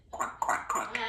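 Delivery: quick quack quack quack like a duck; playful quacking duck sounds. Pretend. Parrot says it is a duck, then quacks. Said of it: "I'm a duck".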